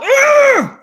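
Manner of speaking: very loud high scream of rage. Retold or imitated. Chain snatched, a very loud high scream. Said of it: "I just screamed out".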